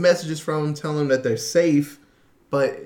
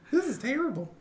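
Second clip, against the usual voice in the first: mock scared kid; 10 semitones higher, 6 dB quieter than usual. Continuing little Mike impression.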